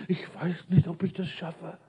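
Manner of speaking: quiet, trailing-off mock doubter; mock self-doubt. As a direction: imitate a half-hearted believer, softly. Mimics doubting believers making excuses.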